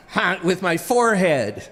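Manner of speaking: ordinary talk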